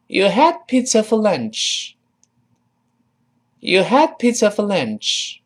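slow, high-pitched teacher's model line; model reading. Shown as a demonstration. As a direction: lead learners through it slowly, pitch lifted. (慢速、提高音调领读)

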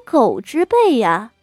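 a cold sneer dressed as sympathy. Acted. Concubine Xue mocks the wife over her daughter, sneering. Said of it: "薛姨娘冷笑着".

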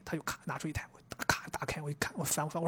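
soft whisper with no voice, building suspense; whispered retelling. Retold or imitated. Telling cohost about the store, in a hushed whisper. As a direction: whisper it like reliving the tense moment.

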